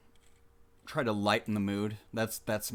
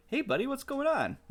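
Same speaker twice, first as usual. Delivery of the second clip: mock innocence. Innocent greeting to angry guy.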